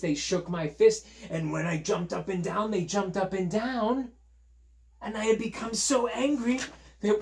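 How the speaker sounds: high, animated character voice; peddler working it out. Acted. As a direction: piece it together with rising wonder. Reader plays the peddler realizing.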